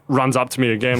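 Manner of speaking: normal talking voice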